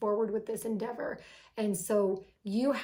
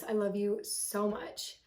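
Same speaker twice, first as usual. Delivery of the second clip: ordinary talk, nothing marked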